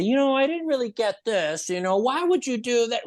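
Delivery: mock audience complaint, high-pitched; critical viewer. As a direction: voice a confused, disapproving viewer. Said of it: "someone that didn't like this".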